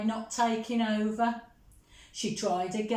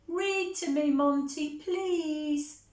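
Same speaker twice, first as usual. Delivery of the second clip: much higher, sweet pleading little mouse; little sister pleading; 6 semitones higher than usual. Acted. Little sister Millie asking Monty to read, high pleading. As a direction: beg sweetly like a tiny sister. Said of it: "Millie would say".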